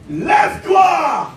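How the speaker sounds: ordinary talk, nothing marked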